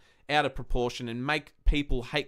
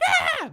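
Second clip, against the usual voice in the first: a sudden piercing yelp, much higher and louder; high scream; 6 dB louder than usual. Retold or imitated. Fan showing how his voice cracked from screaming, high scream. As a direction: let out a hoarse shriek like a voice that's gone. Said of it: "because I screamed that loud".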